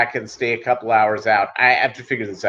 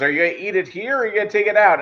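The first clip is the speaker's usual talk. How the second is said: rushed question; 7 semitones higher, much faster than usual. Retold or imitated. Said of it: "he goes".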